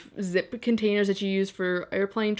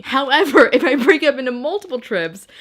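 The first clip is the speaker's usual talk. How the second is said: excited burst; 5 semitones higher, 8 dB louder than usual. Real, not acted. Excited self-justifying outburst.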